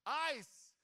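mock coach call. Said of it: "estaba ahí el coach diciéndoles".

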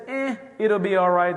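smug "eh" as if nothing could go wrong; cavalier, prideful. Retold or imitated. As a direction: wave the warning away like nothing matters. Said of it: "this cavalier, prideful attitude".

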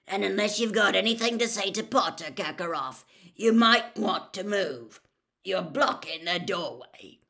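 loud, forceful grim challenge. A narrator's reading. Moody telling Karkaroff to clear the doorway, grim. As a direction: order him aside with grim force. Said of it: "said Moody grimly".

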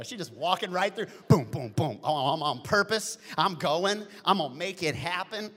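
loud, bouncing imitation of her walk; lively loud mock strut; excited. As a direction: pump it up like her joyful stride.

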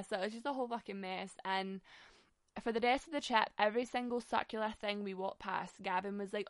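ordinary talk

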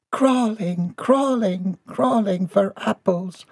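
high, singsong mutter; high-pitched little character voice; muttering old woman. A narrator's reading. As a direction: chant it to yourself in a high voice. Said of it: "she muttered".

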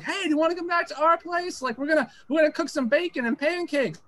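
high-pitched imitation of friendly girls inviting. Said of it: "It was just being friendly".

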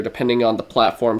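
ordinary talk

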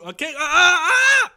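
loud, high shouting beatbox burst; techno beatbox shout. Shown as a demonstration. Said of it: "versuchen, Techno-Beatbox zu machen".

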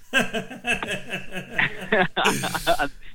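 hearty laugh. Real, not acted. Laugh after punchline.

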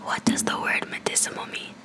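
breathy whisper, a touch clearer; leaning in to whisper. Real, not acted. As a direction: whisper it again, closer to the mic. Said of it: "whisper closer to the microphone".

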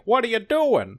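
very high-pitched mock citizen; hammed-up bystander butting in; well-meaning bystander. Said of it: "poor well-meaning citizen is like".

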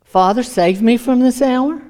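"Father, save me from this hour" lifted into a rising question. Acted. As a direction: try out "save me from this hour" as a doubtful question, climbing to "hour". Weighing a prayer he then rejects.

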